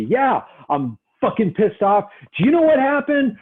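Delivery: high, loud agitated client outburst; acted angry client. Shown as a demonstration. Angry client venting at the counsellor, high and loud. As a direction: play the client pissed off and raising voice.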